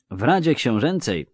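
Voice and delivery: put-on mild hesitant start from a young man; lightly voiced faltering youth; stammering youth. A narrator's reading. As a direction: begin haltingly, unsure of yourself. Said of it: "zająknął się Jarre".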